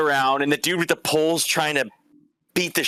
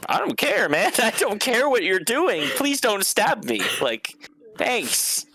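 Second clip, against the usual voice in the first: high, laughing, careless brush-off; giggly mock plea not to be stabbed; laughing dismissively; 9 semitones higher than usual. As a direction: laugh through it like nothing can hurt you. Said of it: "just fucking laughing at this dude".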